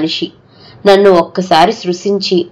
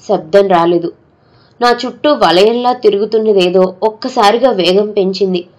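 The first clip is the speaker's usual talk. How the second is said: plain reading voice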